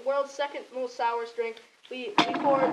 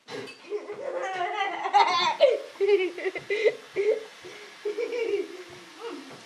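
long laugh with a pained cry; laughing groan; 6 semitones higher than usual. Real, not acted. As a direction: laugh and wince at the sourness. Reacting to sour drink.

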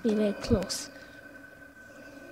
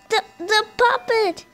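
excited yell; 9 semitones higher, 6 dB louder than usual. Real, not acted. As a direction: shout it out in shock. Spots puppet on camera, yells.

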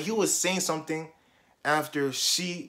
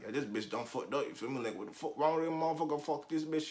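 much softer, under the main talk; retold grumbling aside; 10 dB quieter, faster than usual. Student at the back of class, quiet retold grumbling.

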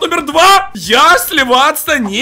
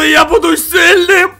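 ordinary talk, nothing marked

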